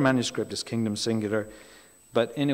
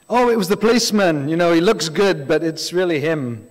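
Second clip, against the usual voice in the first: loud, gleeful blurting out of the twist; mock spoiler blurt; 5 semitones higher, 7 dB louder than usual. Someone spoiling a TV series for a friend, loud gleeful blurt. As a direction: blurt the ending out like you can't hold it in.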